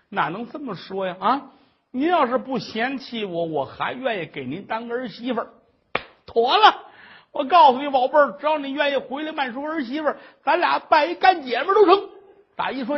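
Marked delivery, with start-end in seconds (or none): 6.2-12.1 higher and louder, rushing with joy; delighted. Acted. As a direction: burst out in glee at the good news. 婆婆高兴得直嚷. (高兴地高声嚷嚷)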